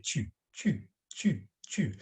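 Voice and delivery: tu with lips set to whistle; short repeated tu, tight French u; pursed-lip tu. Shown as a demonstration. Teacher drilling pupils on the vowel, whistle-lipped tu. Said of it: "as if you're going to whistle".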